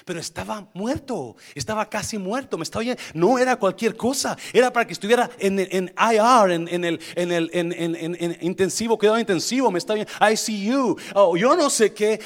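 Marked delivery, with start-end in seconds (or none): 3.1-12.2 loud fervent preaching; preaching at full force. Acted. Preacher stresses how badly Pablo was hurt, loud and fervent. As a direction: drive it home to the church.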